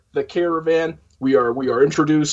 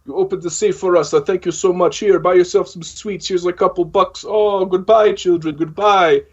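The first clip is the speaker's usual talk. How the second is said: lightly voiced cyborgs, higher and sugary; mock grateful cyborgs; 4 semitones higher than usual. Retelling the movie, cyborgs thank the orphans in a mock sweet voice. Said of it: "They're like".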